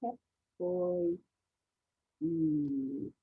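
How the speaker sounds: "K", "Oi", "Mm" apart, each vowel and hum held level; sounding out. Shown as a demonstration. Teacher segmenting coin for children, "K", "Oi", "Mm" separately. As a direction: break coin into "K", "Oi", "Mm". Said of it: "You hear that last sound?".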